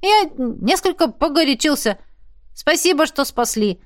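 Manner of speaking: high-pitched, meek apology; sheepish backdown. A narrator's reading. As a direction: climb down without any bravado. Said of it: "мигом стушевался, растеряв всю браваду".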